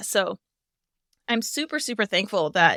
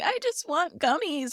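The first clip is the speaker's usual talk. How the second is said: high, soft little whine; whiny; 7 semitones higher, 4 dB quieter than usual. Retold or imitated. Mom imitating her child begging for gummies, whiny. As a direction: imitate a small child whining for a treat. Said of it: "like, whiny tone of".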